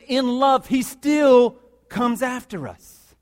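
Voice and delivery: high, loud, drawn-out proclamation; impassioned preaching shout. Real, not acted. Preacher to church on God's love, shouted slowly. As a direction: land the climax at full force. Climax of the sermon point.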